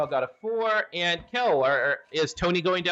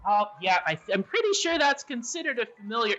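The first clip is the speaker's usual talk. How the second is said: lightly raised, polite gargoyle voice; 6 semitones higher, faster than usual. Acted. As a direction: answer as the courteous gargoyle. Gargoyle speaking.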